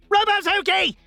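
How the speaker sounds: high-pitched yell of the name; desperate yell. Acted. Trapped birthday boy to the robot, desperate yell. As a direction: yell it out in panic.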